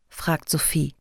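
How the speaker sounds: plain reading voice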